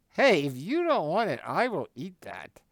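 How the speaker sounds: loud, high joking offer; joking call-out. Retold or imitated. At the fancy dinner, jokingly asking the old woman for her appetizer, loud.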